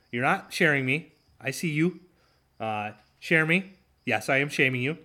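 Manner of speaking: ordinary talk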